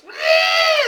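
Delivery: very high, loud shrieking cackle; creepy creature shriek. Retold or imitated. Friend asks for the creepy thing, loud high creature shriek. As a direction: let out a creepy monster shriek. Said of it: "you have to do the creepy thing".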